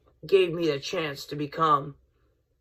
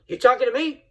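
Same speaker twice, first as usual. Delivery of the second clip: Bobby Heenan line; 6 semitones higher, faster than usual. Retold or imitated. Host quoting Bobby Heenan's line to fans, high and hammed-up. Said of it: "That was one of his, uh, lines".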